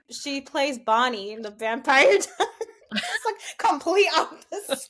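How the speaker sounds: talking through real laughter; giggly, breaking into laughter mid-sentence; laughing. Real, not acted. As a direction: laugh your way through the words, pitch climbing. Laughing at the mix-up of names.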